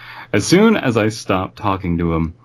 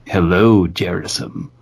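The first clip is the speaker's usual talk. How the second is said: deadpan muffled voice. Retold or imitated. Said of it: "said in a deadpan, muffled voice".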